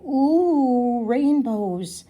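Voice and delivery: high, loud delighted ooh; excited ooh. A narrator's reading. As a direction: gasp at the rainbows with delight. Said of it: "feel really, really excited".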